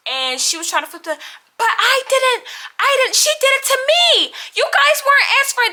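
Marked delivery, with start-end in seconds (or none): 1.6-5.7 much higher mock innocent whine; whiny victim imitation. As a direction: mimic her playing the victim. Imitating roommate playing victim.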